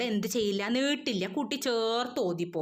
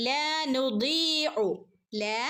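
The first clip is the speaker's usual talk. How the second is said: slow chanted ലാ നുദീഉ; recitation; 3 semitones higher, much slower than usual. Shown as a demonstration. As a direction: stretch the ദീ two beats. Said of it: "യാ മദ്ദും വന്നിട്ടുണ്ട്". (രണ്ടനക്കം നീട്ടി)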